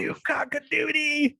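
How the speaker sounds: high, drawn-out imitation of an outraged woman; mock mad outburst. Imitating the mad woman from the movie Misery, mock mad outburst. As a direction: stretch out a high-pitched mock tantrum. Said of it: "where she gets mad".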